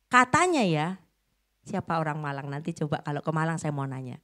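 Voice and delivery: normal talking voice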